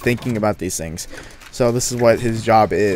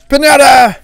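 excited shout; 13 semitones higher, 11 dB louder than usual. Real, not acted. Excited at the pinata room.

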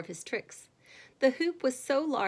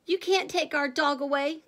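put-on higher-pitched little boy's protest; raised, indignant child's plea; upset cry; 6 semitones higher, 3 dB louder than usual. A narrator's reading. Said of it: "cried Freddie".

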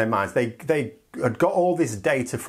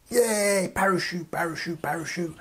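put-on childish cheer fading; 4 semitones higher, 3 dB quieter than usual.

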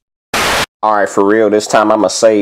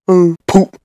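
high silly dubbed voice; squeaky goofy redub voice; silly mismatched redub; 10 semitones higher, 3 dB louder than usual. Acted. Odd put-on voice.

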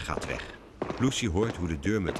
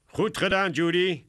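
high, loud villain's praise; pitched-up, crowing approval; pleased cat catcher; 11 semitones higher, 5 dB louder than usual. A narrator's reading. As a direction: praise your little accomplice gleefully. Cat catcher praises his mouse.

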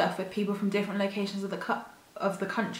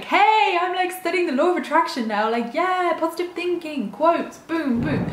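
gushing friend voice; 6 semitones higher than usual. Retold or imitated. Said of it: "me being like".

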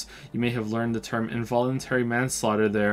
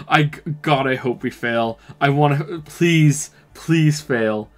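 please please please, louder each time; pleading to fail; 3 semitones higher, 7 dB louder, much slower than usual. Real, not acted. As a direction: beg the dice not to hit. Player begging dice to fail.

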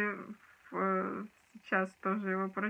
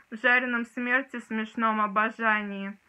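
ordinary talk, nothing marked

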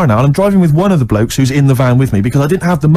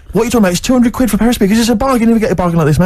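high, insistent sales patter; pushy seller; 7 semitones higher, faster than usual. Retold or imitated. Bloke pushing speakers on an unsure buyer, insistent. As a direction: imitate a seller badgering a doubtful buyer.

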